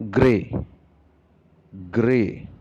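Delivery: 'gray' said clearly, then repeated; model pronunciation. Shown as a demonstration. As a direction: model 'gray' slowly for the class to copy. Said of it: "it is pronounced as".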